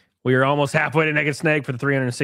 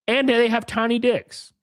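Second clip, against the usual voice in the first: normal talking voice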